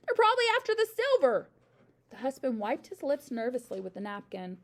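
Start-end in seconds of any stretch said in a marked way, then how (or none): 0.1-1.5 high, loud, anxious warning; urgent hiss. A narrator's reading. Mother fretting that burglars want silver, urgent voiced hiss. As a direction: press the alarm urgently. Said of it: "hissed the mother".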